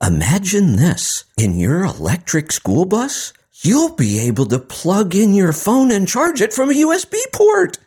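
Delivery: put-on wide-eyed, as if to small children; Kamala Harris impression. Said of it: "then she went on to say".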